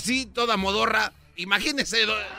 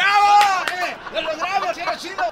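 loud, high victory whoop; triumphant cheer; 7 semitones higher, 5 dB louder than usual. Acted. Lawyer cheering after the judge's guilty verdict. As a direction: cheer like you just won the case.

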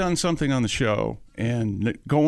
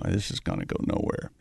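whispering; 7 semitones lower, 7 dB quieter than usual. Retold or imitated.

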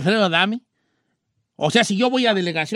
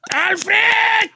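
King Kong challenge; 9 semitones higher, much slower than usual. Pretend. Daring Alfredo to come out, shouting like King Kong. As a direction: bellow at him to come out and fight. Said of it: "Así como King Kong".

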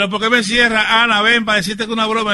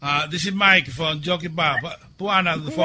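broken English; 6 semitones lower, 6 dB quieter, slower than usual. Acted. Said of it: "el inglés mío no es muy bueno".

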